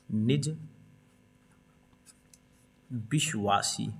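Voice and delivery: निज, long pause, then a drawn-out विश्वासी; slow dictation. Shown as a demonstration. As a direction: dictate निज विश्वासी word by word while writing. Dictating while writing it down.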